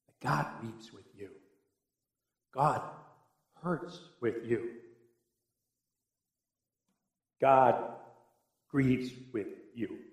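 hushed compassionate assurance; tender. Real, not acted. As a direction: soothe those in pain. Tender consolation of the hurting.

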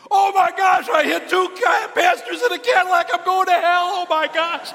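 high, loud panicked wail; freaking out. Retold or imitated. Kid learning he hit two pastors, freaking out loudly. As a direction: shout it like the world is ending. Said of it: "And he's freaking out".

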